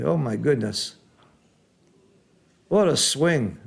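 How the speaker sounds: normal talking voice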